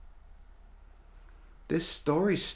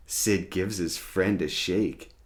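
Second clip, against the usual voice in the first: usual speaking voice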